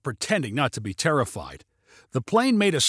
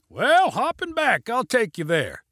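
shouted invitation; 8 semitones higher than usual. A narrator's reading. Pilot invites hitchhiker aboard, high shout. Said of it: "shouted Junie".

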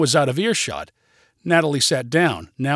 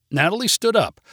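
usual speaking voice

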